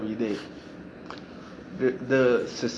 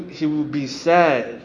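ordinary talk, nothing marked

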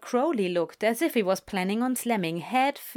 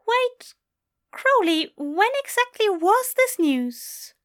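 high, loud, drawn-out questioning; dawning suspicion; 13 semitones higher, 5 dB louder, slower than usual. Acted. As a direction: question him as realization dawns. Angel grows suspicious, questions demon.

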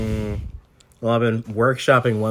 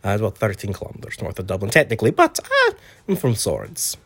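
put-on light Irish accent; an Irishman from Swords; Irish accent, lightly done; 4 semitones higher than usual. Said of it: "What's your accent from?".

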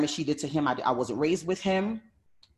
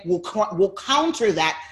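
ordinary talk

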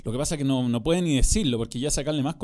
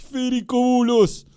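high, loud, stretched-out commentator calls; hammed-up beach soccer commentary; sports commentator shout; 10 semitones higher, 7 dB louder than usual. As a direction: belt out names like a goal call. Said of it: "Yo comenté Mundial de Fútbol Playa".

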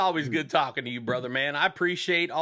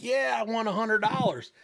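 higher-pitched mock triumph; retold glee; 7 semitones higher than usual. Joke teller voicing the happy bet winner, mildly gleeful. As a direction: lightly imitate a gleeful winner. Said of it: "the guy was super happy".

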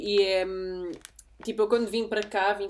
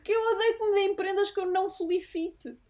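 exasperated retort; 10 semitones higher than usual. Retold or imitated. Retelling her complaint to boyfriend.

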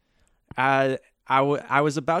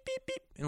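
high, quick electronic beeps; imitated beeping; 13 dB quieter than usual. Podcaster imitates his broken hard drive, high soft beeping. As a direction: beep like a failing hard drive. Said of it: "It started going".

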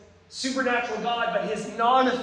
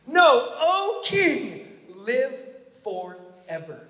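slow sincere blessing; 3 semitones higher, 5 dB louder, much slower than usual. Pretend. Daniel answering the king from the den, reverent. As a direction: bless him slowly and earnestly. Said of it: "He should be like".

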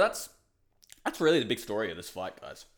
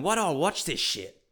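exasperated inner voice; 7 semitones higher, 5 dB louder than usual. Retold or imitated. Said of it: "And then I'm thinking".

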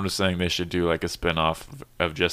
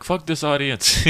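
higher, slow mock outrage; mock indignation; 9 semitones higher, 4 dB louder, slower than usual. Comic retelling his reaction to a joke nobody laughed at, mock indignation. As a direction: drag it out in mock disgust at the crowd. Retold reaction to a silent crowd.

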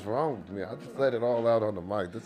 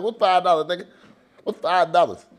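loud, higher retort; retold shout; 7 semitones higher, 8 dB louder than usual. Retelling his comeback to the friend, loud high retort. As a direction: throw it back at him loud and high.

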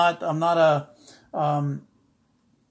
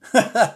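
real laugh; 6 semitones higher, 7 dB louder than usual. Real, not acted. Laughing at the retold reply.

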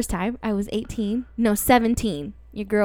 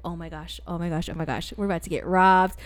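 retold panic; 7 semitones lower, 7 dB quieter than usual. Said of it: "And we were like".

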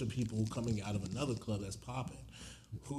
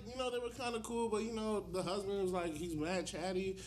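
high mock wife voice; 7 semitones higher than usual. Voicing the wife.

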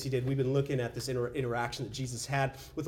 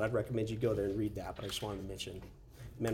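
ordinary talk, nothing marked

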